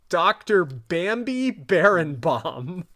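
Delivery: normal talking voice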